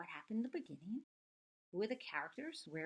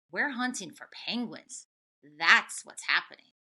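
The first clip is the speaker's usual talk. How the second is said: louder growled answer; 12 dB louder than usual. Acted. Hunters growling at Tacky, louder gruff answer. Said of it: "They growled".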